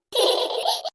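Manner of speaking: high-pitched giggle; a short burst of high giggling. Acted. Daughter giggles after asking mother about men coming, giggling.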